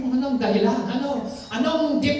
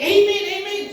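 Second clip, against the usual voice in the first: joyful amen; 8 semitones higher than usual. Acted. Quoting people's response in sermon.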